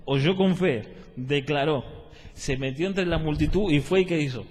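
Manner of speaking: loud, punched-out key words; forceful, deliberate sermon delivery; emphatic preaching. Real, not acted. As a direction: hammer each step home to the congregation. Preaches with raised voice.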